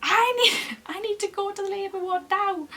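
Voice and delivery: high-pitched, urgent call; retold shout. Shouting to hospital staff for the labour ward, urgent retold yell. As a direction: shout it out high, drawing out the need. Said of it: "I just had to shout".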